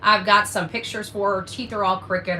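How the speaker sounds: usual speaking voice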